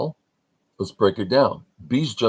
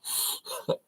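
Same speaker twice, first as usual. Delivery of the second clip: breathless laugh; 11 dB quieter than usual. Real, not acted. Laughter after guess.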